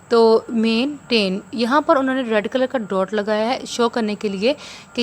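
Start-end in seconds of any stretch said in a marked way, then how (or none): 0.5-1.4 "maintain" slowly split as मेन, टेन; syllables broken apart. Shown as a demonstration. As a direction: break "maintain" after मेन. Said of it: "जो sound टूट रही है". ("Maintain" को धीरे, मेन और टेन में तोड़कर)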